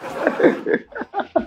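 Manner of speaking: airy unvoiced laugh; breathy laugh. Real, not acted. Laughing at the rooster impression.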